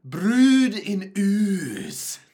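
put-on very drawn-out Scottish accent; exaggerated Scottish, vowels stretched long; mock Scottish accent. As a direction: ham up a slow Scottish accent. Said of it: "Say it with a Scottish accent".

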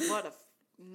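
ordinary delivery

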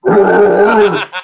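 loud imitation of barking; mock dog barks; imitated dog barking. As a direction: bark like an angry little storytelling aside. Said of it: "started barking even louder".

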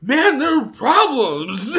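high, loud mock holler, drawn out; Bobby yelling. Podcaster acts out Bobby's funeral outburst, yelling. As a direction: yell it slowly, breaking into laughter. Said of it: "yelling under that tree".

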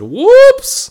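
high squeaky mock whoops. Guy mocking his own text as a squeaky voicemail. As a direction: squeak it out in a silly falsetto. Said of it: "I should have sent a voicemail that said".

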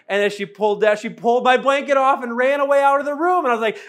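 ordinary delivery

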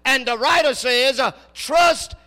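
loud, rising preacher's cadence, slow and weighty; preaching at full force. Acted. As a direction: preach it out to the congregation. Preacher building to a climax.

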